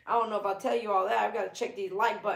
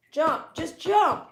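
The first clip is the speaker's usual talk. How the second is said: raised, higher-pitched coaxing command; urging a dog; 5 semitones higher, 3 dB louder than usual. Real, not acted. Owner telling the dog to jump, urging. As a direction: egg the dog on, voice lifted.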